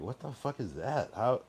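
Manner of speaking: high, baffled outburst; mock shocked what-the; stunned disbelief. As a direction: react like you can't believe your ears. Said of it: "And I'm like".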